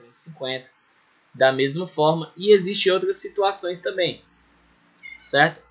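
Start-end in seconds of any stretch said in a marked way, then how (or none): none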